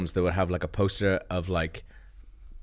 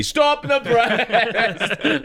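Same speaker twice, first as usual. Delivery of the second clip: dramatic shout; 12 semitones higher, much slower than usual. Pretend. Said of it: "you're just like".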